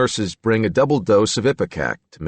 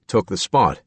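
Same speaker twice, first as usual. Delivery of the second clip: ordinary delivery, nothing marked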